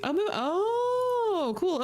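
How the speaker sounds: very high, stretched "oh, move, oh"; slow amazed coo; drawn-out surprise. Real, not acted.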